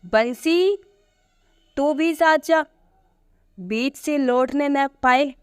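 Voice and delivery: high-pitched summons to a servant; calling out. A narrator's reading. Narrator summons Bansi to go along with them, calling out. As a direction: call out an order, pitched well up. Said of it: "मैंने पुकारा".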